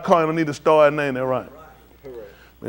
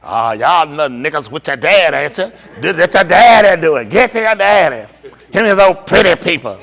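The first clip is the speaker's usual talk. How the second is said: mock scolding, louder; imitating his wife; 5 dB louder than usual. Husband mocks wife scolding kids, louder mock scolding. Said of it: "she gonna tell".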